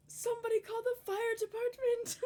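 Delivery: high, whiny wail for help; mock panic. Teasing the stuck sibling's cry for rescue, mock panic. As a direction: cry out as a trapped child, hammed-up.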